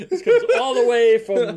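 said through loud high laughter; laughing. Real, not acted. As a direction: push the words out while cracking up. Laughing through repeated phrase.

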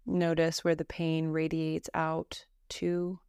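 slow, even meditation voice with long pauses; calm Yoga Nidra guidance, evenly pitched; guided meditation. Acted. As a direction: guide them gently through breath and body, unhurried.

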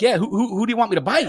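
quick, high-pitched voicing of a baffled dog; confused dog. Dog looking up at its handler, lightly voiced confusion. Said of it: "the dog's looking at you like".

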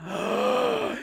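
sharp high gasp of delight; huge excited gasp. Real, not acted. Fan seeing his team score, a big gasp. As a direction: gasp as the puck goes in.

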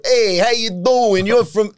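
lightly hammed Jersey guy; New Jersey accent. Comedian imitating people on the road in a Jersey accent. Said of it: "people said, "Oh, you're from New Jersey".

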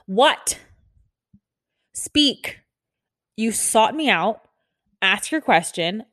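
loud, slowing, emphatic order; exasperated demand. Acted. Fed-up nurse to someone interrupting her, exasperated. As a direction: demand it word by word, impatient and loud.

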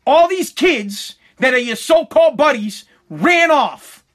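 imitated indignant scolding; pissed-off father. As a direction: lay it out like an angry dad, phrase by phrase. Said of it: "he was pissed".

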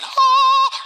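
high, loud sustained "ah"; singing rock. Pretend. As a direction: belt one long high note as a singing rock. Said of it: "those rocks start singing".

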